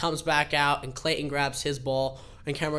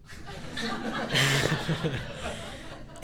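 breathy quiet laugh before the story; a soft giggle to open; 4 dB quieter than usual. Real, not acted.

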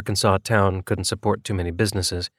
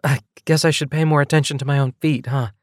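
lightly voiced woman, higher pitch; a higher character voice owning up to clumsiness; sheepish, higher-pitched admission; female character, self-mocking; 5 semitones higher than usual.

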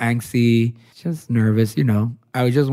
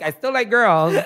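laughing quote; 9 semitones higher than usual. Retold or imitated. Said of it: "I was like".

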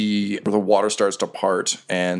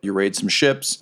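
ordinary delivery

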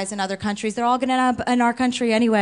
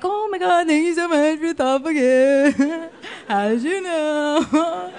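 hammed-up fake gratitude, drawn out at the end; mock gushing bride; 2 semitones higher, slower than usual. Comic mocking a bride thanking for a registered gift, fake gushing.

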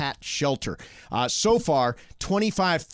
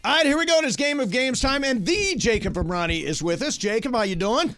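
game show host hype; 10 semitones higher than usual. Acted. Host opening the game segment.

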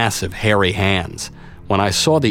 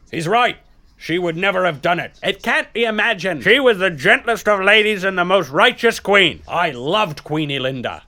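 voice from the crowd; 10 semitones higher than usual. Acted. Crowd voice agreeing.

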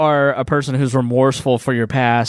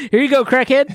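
mock flippant official; 10 semitones higher than usual. Mocking the court's attitude.

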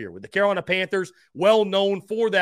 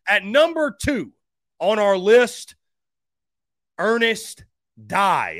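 top ten countdown; 3 semitones higher, 4 dB louder, slower than usual. Real, not acted. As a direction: build it up like a countdown host. Reveals number two pick.

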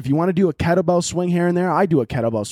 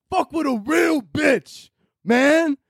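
much higher, slow hollering bro voice; shouted bro boast; 12 semitones higher, much slower than usual. Retold or imitated. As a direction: yell it like a gym bro crowing. Hyping himself after gym compliment.

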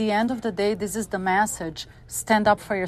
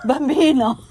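laughing; 5 semitones higher, 7 dB louder than usual. Real, not acted. Echoing the Italian word, laughing.

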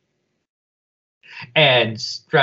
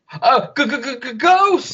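high, stuttering terrified ghost; stammering scared ghost cry; 12 semitones higher, 3 dB louder than usual. Shown as a demonstration. Spotting a ghost on the ship, stuttering fright. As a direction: stutter it out like a spooked cartoon. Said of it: "I'm saying it the wrong way".

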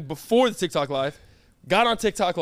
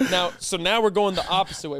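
ordinary talk

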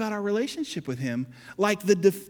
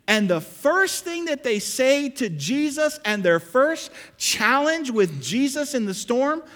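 higher, louder preaching voice; full-force preaching; 6 semitones higher, 5 dB louder than usual. Acted. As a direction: drive the story home to the congregation. Preaching builds on the storm.

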